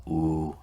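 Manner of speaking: U held as a long oo. Shown as a demonstration. Teacher showing the second symbol of Om, long oo for U. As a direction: say U as a drawn-out oo vowel. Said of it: "which is the U sound".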